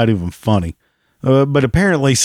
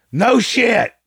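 much higher, mocking squeak; squeaky sarcasm; 14 semitones higher than usual. Real, not acted. Vlogger echoing his own jab, squeaky and mocking.

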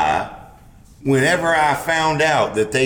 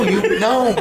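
normal talking voice

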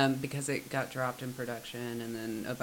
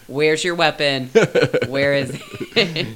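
loud; 2 semitones higher, 13 dB louder than usual. Retold or imitated. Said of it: "I'd be like".

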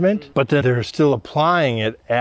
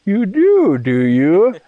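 much higher and louder; delighted surprise; 8 semitones higher, 5 dB louder, slower than usual. Retold or imitated. Excited surprise on hearing the old brothers strip till. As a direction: burst out in glad surprise.

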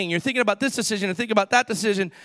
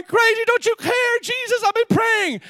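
much higher, whiny frantic cry; panicked complaint; 10 semitones higher than usual. Retold or imitated. Believers crying out to Jesus over their troubles, high panicked wail. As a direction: wail at him as if going crazy. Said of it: "And we're going".

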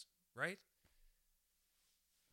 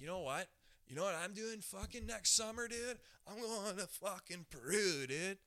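higher-pitched put-on dude voice; excited dude; 7 semitones higher, 4 dB louder than usual. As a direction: play a hyped-up guy sharing big plans. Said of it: "There's people right now that are like".

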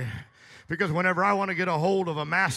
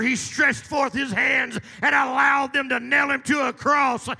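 higher, louder, rising preacher's cry; full-force preaching; 5 semitones higher, 9 dB louder than usual. Acted. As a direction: preach it out, holding nothing back.

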